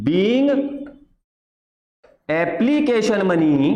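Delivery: very slow, with long pauses between phrases; slow dictation. Shown as a demonstration. Teacher dictating the narration to students copying it down, slow and spaced. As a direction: spell it out phrase by phrase for students to copy. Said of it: "pause करके copy करते रहना".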